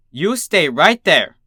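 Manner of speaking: slow, loud firm command; ordered. A narrator's reading. Teacher ordering Dinky to stay on the stoop, slow and firm. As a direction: plant every word like an order. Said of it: "she ordered".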